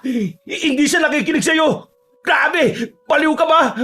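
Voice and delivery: very high, frantic scolding; high-pitched, incredulous yelling; panicked exasperation. Acted. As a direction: scold your friend while running for your life. Exasperated friend yells while fleeing.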